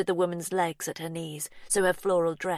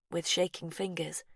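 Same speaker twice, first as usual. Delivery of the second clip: ordinary delivery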